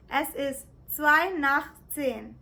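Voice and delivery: careful, slow German; "drei vor zwölf" said slowly and clearly; slow German demo. Shown as a demonstration. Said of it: "You can say it as".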